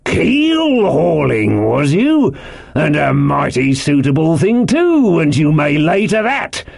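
high, drawn-out, threatening relish; blustering landlord passing grim judgement; scornful echo. A narrator's reading. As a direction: throw the words back in a stretched sailor voice. Silver throws Morgan's answer back.

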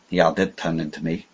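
plain reading voice

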